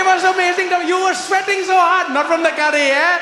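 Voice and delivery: high, loud, gleeful Indian waiter; emphatic, high-pitched Indian accent. Retold or imitated. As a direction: crow loudly in an Indian accent. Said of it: "I also do accent".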